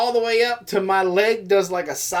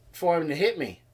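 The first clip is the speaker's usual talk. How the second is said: normal talking voice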